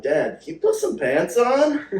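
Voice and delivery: high, loud, slowed exasperation; exasperated plea. Retold or imitated. Son begging his football-crazed dad, high and loud. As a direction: cry out at dad in mock horror. Joke reply to excited dad.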